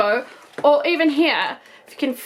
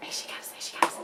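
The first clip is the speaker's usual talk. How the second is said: excited whisper; 10 dB quieter, faster than usual. Real, not acted. Doll coming out of box.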